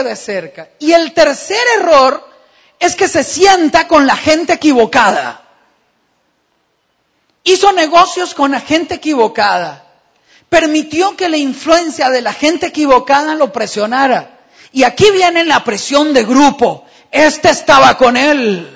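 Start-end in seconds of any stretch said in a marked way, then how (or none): 0.8-16.8 loud, high preaching climax; full-force preaching. Acted. 17.1-18.7 loud, slow accusing cry; loud accusation repeated by the preacher; accusing shout. Acted. Re-voicing the accusation.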